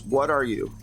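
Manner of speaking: usual speaking voice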